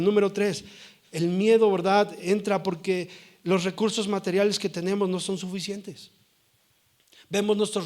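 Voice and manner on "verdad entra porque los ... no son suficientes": ordinary talk, nothing marked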